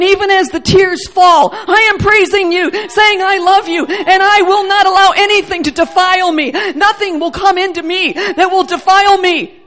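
building to loud, high, forceful preaching; impassioned pulpit crescendo, unrelenting; fervent declaration. Real, not acted. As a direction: proclaim it at full force.

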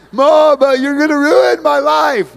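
loud, high mock-wail of a child; whiny kid. Child protesting to mom about sugar, loud wail.

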